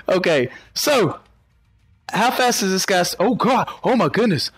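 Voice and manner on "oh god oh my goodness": normal talking voice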